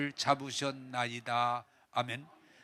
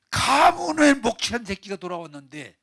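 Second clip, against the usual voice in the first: loud, raised burst of outrage; angry older brother; 6 semitones higher, 11 dB louder than usual. Acted. Older brother railing about the prodigal's return, angry. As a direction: rail against a disgraced sibling.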